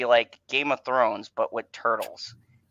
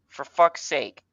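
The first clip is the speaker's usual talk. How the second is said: usual speaking voice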